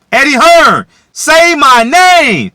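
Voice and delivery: very high, very loud, slow drawn-out scream; full scream. Real, not acted. Calling out Eddie Hearn, screaming at full force. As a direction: scream the name like a wrestler.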